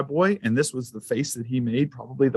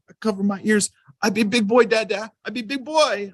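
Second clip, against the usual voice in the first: put-on high, proud toddler voice, much higher; 9 semitones higher than usual. Dad imitating son promising to be brave, high toddler voice. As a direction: chirp it like a proud little boy.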